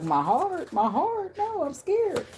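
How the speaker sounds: high, thin mock-frightened voice; scared squeak. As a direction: play a panicky inner self. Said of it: "you like".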